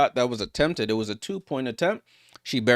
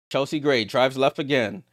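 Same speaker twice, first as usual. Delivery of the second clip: usual speaking voice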